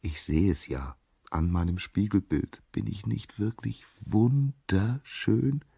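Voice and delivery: soft, slow, rising self-admiration; dreamy vanity, drawn out; self-enchanted. A narrator's reading. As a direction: linger over every word, besotted. Narcissus adores his mirror image.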